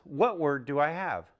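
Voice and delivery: ordinary talk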